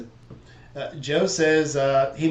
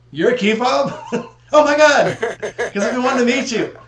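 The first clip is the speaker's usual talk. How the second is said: high, loud, laughing burst of delight; excited laughing; 9 semitones higher, 6 dB louder than usual. Retold or imitated. Retelling meeting Key Fob at camp out, laughing excitedly. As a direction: gush with laughter at meeting an online friend.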